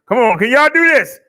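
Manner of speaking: loud, fast hype call to the crowd. Acted. Man hyping his listeners over his own beat, excited. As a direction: get the room going.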